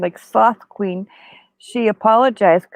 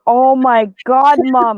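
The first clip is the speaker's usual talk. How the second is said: louder, slow astonished exclaim; kids amazed; 3 semitones higher, 6 dB louder, slower than usual. Retold or imitated. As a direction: draw out the shock of seeing the likeness. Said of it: "and they go".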